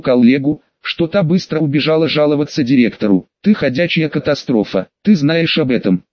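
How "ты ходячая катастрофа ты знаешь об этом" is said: ordinary delivery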